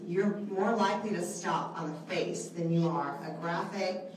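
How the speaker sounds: normal talking voice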